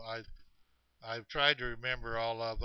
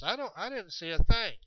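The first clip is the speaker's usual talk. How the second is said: mildly exasperated, higher-pitched denial; insist, pitch raised; protesting; 7 semitones higher, 3 dB louder than usual. Retold or imitated. Chet answering excited DJ, mildly protesting. Chet's acted reply to DJ.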